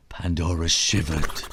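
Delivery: ordinary delivery, nothing marked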